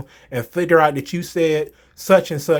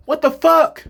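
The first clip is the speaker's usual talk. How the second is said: exasperated outburst; 8 semitones higher, 4 dB louder than usual. Real, not acted. Exasperated at apology critics.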